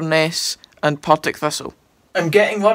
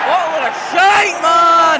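angry shout at the team; yelling from the sidelines; 9 semitones higher, 4 dB louder, slower than usual. Real, not acted. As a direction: bellow it in disgust. Fan yelling at football match.

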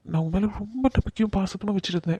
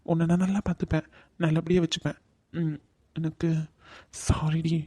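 hushed, tender promise; soft apologetic reassurance; 2 semitones lower, 6 dB quieter than usual. Acted. Man promising his crying partner care, softly apologetic. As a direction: reassure gently in a small soft voice.

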